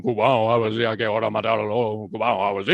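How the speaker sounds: low, guttural Cuban accent, loud and marble-mouthed; exaggerated Cuban mumble, as if the mouth is full of marbles. Shown as a demonstration. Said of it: "Cubans, they speak very low and guttural".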